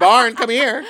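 loud, high call-over; mock summons. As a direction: playfully holler for a partner. Pretends to call over Barn.

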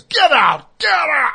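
shouting. Retold or imitated. Wife shouting the drunk out of the apartment, full yell. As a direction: yell to throw him out.

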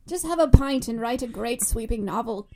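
put-on muddled British accent drifting toward Irish; loud, wobbly British-Irish accent attempt. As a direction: have a go at British and miss. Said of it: "what accent were you going for there".

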